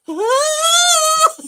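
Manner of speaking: high, loud panicked squeal; frightened squeal. Retold or imitated. Wife squealing at a spider on her shoulder. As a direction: shriek in terror, trying not to move. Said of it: "I'm sitting there going".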